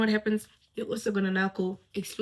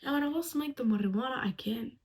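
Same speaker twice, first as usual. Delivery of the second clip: normal talking voice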